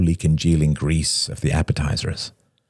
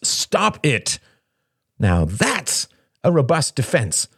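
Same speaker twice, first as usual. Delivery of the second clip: cried out; 10 semitones higher, slower than usual. A narrator's reading. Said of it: "cried Shane".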